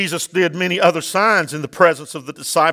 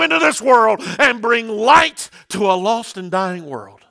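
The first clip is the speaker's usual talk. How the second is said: preaching at full force; 5 semitones higher than usual. Acted. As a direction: declare it boldly over the room. Declares to the congregation.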